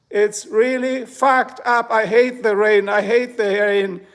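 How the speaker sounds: slow, emphatic, exasperated venting; fed up, leaning hard on each word; worked-up rant. Real, not acted. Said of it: "So now I gotta calm down".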